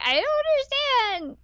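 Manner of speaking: imitating the confused heroine; clueless girl. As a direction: squeak it out, utterly confused. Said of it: "she's like".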